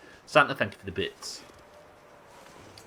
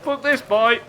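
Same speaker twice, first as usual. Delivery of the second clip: loud shout; 12 semitones higher, 6 dB louder, slower than usual. Real, not acted. Risky dash in game.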